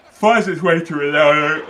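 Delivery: words stretched by a yawn. Real, not acted. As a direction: talk through a yawn. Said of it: "I don't know why I'm yawning".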